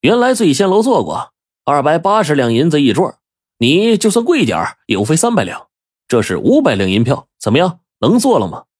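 lightly acted nonchalance; relaxed, casual. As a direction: toss it off as no trouble. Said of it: "五爷轻松地说".

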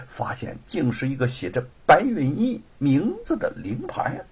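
usual speaking voice

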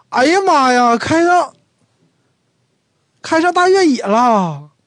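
"哎呀妈呀" shouted in amazement at the classmate's SUV; wrong exclamation, surprised. Shown as a demonstration. Wrong-word demo: to an old classmate with an SUV, "哎呀妈呀" high and loud. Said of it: "这时候就不能说 "哎呀妈呀".

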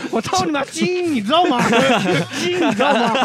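high, loud mock Northeastern tirade; hammed-up shouting Dongbei tough; mock Dongbei rant. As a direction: yell it like a crude Northeastern guy lecturing on genes. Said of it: "你要不要来一句东北那个".

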